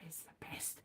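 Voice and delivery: unvoiced whisper of "It's the best". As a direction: breathe "the best" as a secret. Whisper then high repeat.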